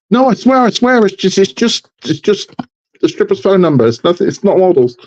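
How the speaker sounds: high-pitched, stammering comic protest; hammed-up pleading of a caught-out husband; panicked denial. Husband hiding a model from his wife, mock panicked.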